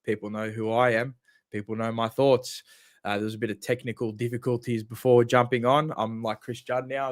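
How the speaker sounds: normal talking voice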